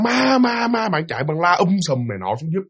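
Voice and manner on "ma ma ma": higher, drawn-out cry without extra volume; mock scream. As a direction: imitate a frightened ghost alarm, held back.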